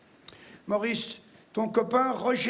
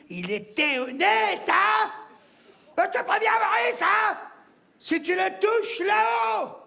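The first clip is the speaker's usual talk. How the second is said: high, escalating furious threats; getting worked up; 8 semitones higher than usual. Acted. Widow threatening her dead husband Maurice, furious shouting. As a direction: lose your temper, yelling much higher than usual. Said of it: "Eh ben oui, je m'énerve".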